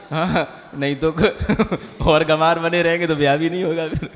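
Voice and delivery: laughing through the joke. Real, not acted. Teacher to students, joking about marriage while laughing. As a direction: chuckle while teasing the students.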